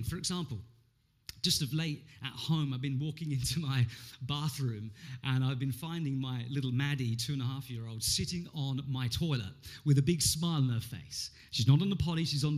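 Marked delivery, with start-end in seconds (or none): none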